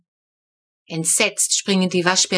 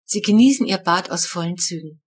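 ordinary delivery

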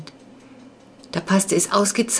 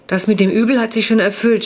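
higher, louder mock groan of a boy; 4 semitones higher, 5 dB louder than usual.

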